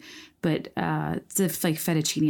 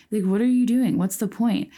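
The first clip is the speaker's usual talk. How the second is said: mock exasperation; 6 semitones higher, 5 dB louder than usual. Real, not acted. Mock-exasperated question about shaped pasta.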